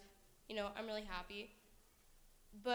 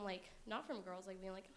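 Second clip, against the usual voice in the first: usual speaking voice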